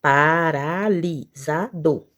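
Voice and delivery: "Paralisado" in five slow beats, pa-ra-li-sa-do; syllable by syllable. Shown as a demonstration. Teacher counting five syllables, "Paralisado" slowly separated. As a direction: draw out each syllable of "Paralisado". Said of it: "cinco sílabas".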